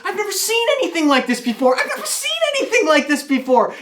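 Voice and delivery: put-on very high, fast, excited little-kid voice; impossibly high toddler. Toddler nephew amazed by his present, excited high voice. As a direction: gush like an amazed small child. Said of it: "his voice is impossibly high".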